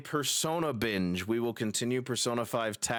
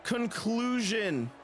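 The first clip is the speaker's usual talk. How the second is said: wrestling commentator; 7 semitones higher than usual. Acted. Wraps up match commentary.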